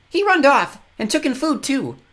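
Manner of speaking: put-on grubby little boy, high and loud; a cheeky child telling on his brother; tyke calling out. A narrator's reading. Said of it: "a tyke called to them".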